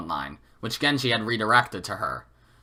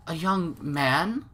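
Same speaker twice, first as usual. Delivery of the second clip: slow, puzzled question, pitched higher; 6 semitones higher, 3 dB quieter, much slower than usual. Acted. Wife puzzled by the caller, slow and wondering. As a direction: let the doubt stretch out.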